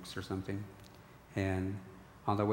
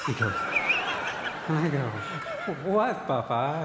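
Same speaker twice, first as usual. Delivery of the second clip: laughing through the words, higher and louder; 6 semitones higher, 7 dB louder, slower than usual. Real, not acted. Son retelling his father's question, laughing. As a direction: crack up while telling it.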